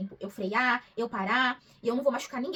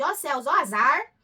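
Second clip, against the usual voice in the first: hammed-up, slower self-pity; mock lament; 7 dB louder, slower than usual. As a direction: play the poor victim. (lamento teatral de vítima)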